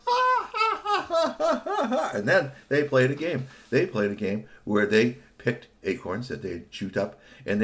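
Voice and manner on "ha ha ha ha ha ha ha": high squirrel cackle; mocking laugh. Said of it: "they sat there and laughed".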